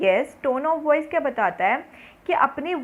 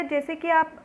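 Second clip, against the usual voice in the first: ordinary delivery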